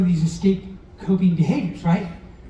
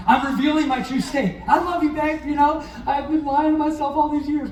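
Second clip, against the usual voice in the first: mock love confession; 6 semitones higher than usual. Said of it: "you can say this".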